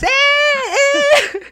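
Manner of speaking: very high cheering yell; cheering shout. Retold or imitated. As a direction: yell it like an excited kid at the front. Imitating himself cheering on video.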